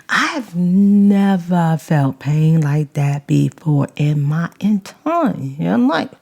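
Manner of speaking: normal talking voice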